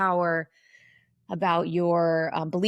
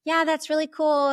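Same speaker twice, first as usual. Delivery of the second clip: put-on much higher, bright girly gush in the head; mock high head voice; 10 semitones higher, 4 dB louder than usual. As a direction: parody the way women are taught to sound up in the head. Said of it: "we're taught to be in our head".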